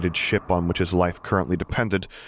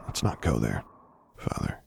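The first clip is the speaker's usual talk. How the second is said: softer, lower, a leashed anger; quiet warning; 8 semitones lower, 7 dB quieter than usual. A narrator's reading. Son warning his father, quiet restrained anger. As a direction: keep the anger muzzled and low.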